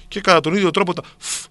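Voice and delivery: normal talking voice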